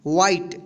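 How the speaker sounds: "व्हाइट" as one syllable, silent final e; correct pronunciation. Shown as a demonstration. Teacher repeats the right reading, "व्हाइट" with silent e. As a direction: close "व्हाइट" on the t, no vowel after. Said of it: "क्या पढ़ेंगे?".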